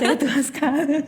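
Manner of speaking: comic self-pity through laughter; laughing. Real, not acted. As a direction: wail it as a joke while laughing. Joking about own age.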